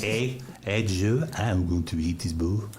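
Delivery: put-on French accent with dropped H's. Shown as a demonstration. Actor demonstrates his newly learned accent, a makeshift French accent. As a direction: try a rough French accent. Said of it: "I was doing the French accent".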